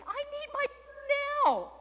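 high, insistent kitten voice; impatient kitten. A narrator's reading. Kitten told to wait demands her purr, impatient and high.

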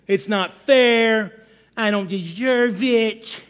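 drawn-out, self-pitying mock whine; Charlie Brown. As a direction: whine it slowly like a big baby. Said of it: "Charlie Brown syndrome".

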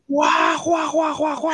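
high shouted wows, fading; imitating a bus crowd gasping wow.

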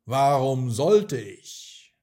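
loud, slow mocking dragon. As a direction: sneer it out like a dragon. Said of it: "Der Drache lachte".

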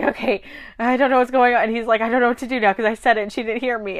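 fast, panicky rambling; freaking out. Retold or imitated. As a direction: babble in a rush, panicked. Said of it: "he's, like, freaking out".

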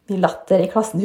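ordinary talk

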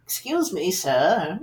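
woman in story. Retold or imitated. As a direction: lightly imitate a woman asking politely. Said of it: "She said".

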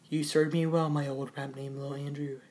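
ordinary talk, nothing marked

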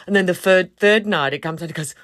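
normal talking voice

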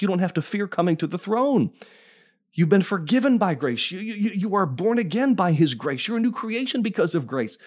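high, driving preacher delivery; full-force preaching. Acted. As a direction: lift the voice and preach it hard.

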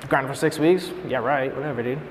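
low, soft dismissive grumble; sulky muttering. Retold or imitated. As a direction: grumble it to yourself, acting the sullen teen. Sulking in awkward car ride.

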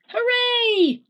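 high, loud hooray; cheer. Acted. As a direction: cheer with all your joy. Dad cheers.

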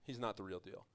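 a sly leader undercutting Jesus, hushed low; scheming Pharisee. Retold or imitated. Pharisees whispering to the crowd, low and sly. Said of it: "show the crowds that".

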